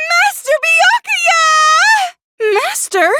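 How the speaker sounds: high shrill cry of his name; a desperate shout for her master; calling out. Acted. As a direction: call across the distance to him.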